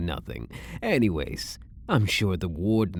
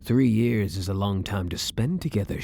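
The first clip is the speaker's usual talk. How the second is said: ordinary talk, nothing marked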